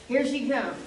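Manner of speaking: ordinary talk, nothing marked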